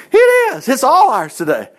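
high, loud burst of joyful correction; emphatic proclamation. Real, not acted. Preacher telling congregation the promise is theirs, emphatic proclamation. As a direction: leap up high and loud, then ease slightly. Preacher loudly overturns his ironic remark.

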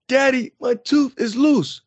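high-pitched imitation of an upset little boy; child about to cry. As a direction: mimic a small son on the verge of bawling. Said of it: "he's about to just start bawling, crying".

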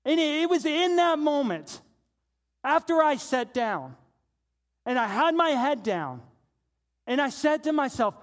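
fervent sermon voice; impassioned preaching. Real, not acted. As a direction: preach it with building force. Preaches at raised force.

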